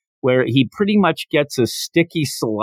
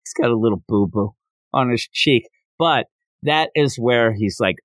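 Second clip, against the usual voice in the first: usual speaking voice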